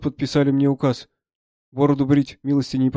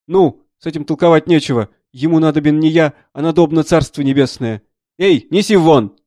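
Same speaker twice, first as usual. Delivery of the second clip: loud, raised dismissal ending in a call; brusque army doctor; 3 semitones higher, 8 dB louder than usual. Acted. Doctor dismissing a dying man to his helpers, loudly brusque. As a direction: order the hopeless case taken away.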